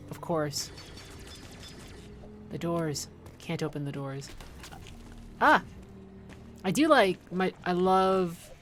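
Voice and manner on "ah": short, loud "ah"; one sharp cry of surprise; jolted mid-game; startled yelp. Real, not acted. Startled during game play.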